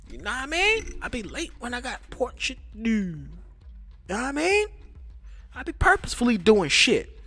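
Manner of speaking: high, loud hammed-up street voice. Greeting his livestream in a hammed-up high voice. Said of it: "I hit the live button and I was like".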